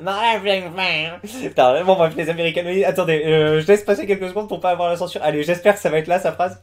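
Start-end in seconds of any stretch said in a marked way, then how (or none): 0.0-1.5 lightly mocking, drawn-out echo of an American speaker; imitating interviewee. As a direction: mimic the interviewee slowly. Mimics the interview clip just played. (imitation traînante de l'interviewée)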